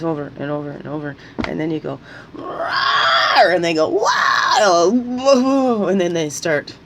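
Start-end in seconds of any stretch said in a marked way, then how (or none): 2.3-3.5 a short unvoiced mock roar, louder; imitating losing her temper with a roar. Mimics snapping after provocation. 3.9-5.9 high, loud mocking "wow"; a hammed-up gasp of outrage; mock shocked wow. Voices them reacting shocked.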